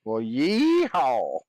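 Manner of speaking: drawn-out slow cowboy yeehaw. Acted. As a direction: give it a big cowboy holler. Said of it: "you sound like a cowboy".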